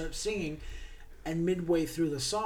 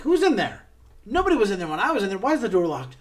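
high, loud, rushed alarm; frantic yelling; 4 semitones higher, 9 dB louder, much faster than usual. Retold or imitated. Raoul yelling through the locked dressing room door, frantic. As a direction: pound on the door and demand answers.

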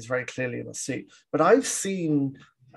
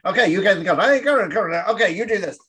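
higher and louder, rapid barked commands; urgent orders; 5 semitones higher, 4 dB louder, faster than usual. Retold or imitated. Host mimicking the film's overlapping dialogue, louder and urgent. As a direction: soldiers shouting over each other in a panic, hammed up.

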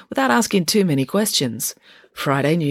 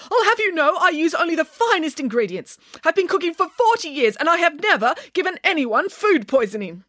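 high-pitched, affronted cook; indignant yell; 12 semitones higher than usual. A narrator's reading. Offended cook defends forty years of cooking, indignant and high. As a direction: protest in a high, outraged voice. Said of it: "yelled Missus Marigold".

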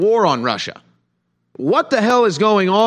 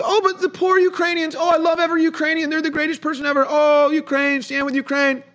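much higher, sing-song mocking falsetto; mocking Ukraine supporter; 11 semitones higher than usual. Commentator mocking pro-Ukraine crowd, high falsetto sneer. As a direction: mimic a gushing fan in a high, silly voice. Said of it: "It's like".

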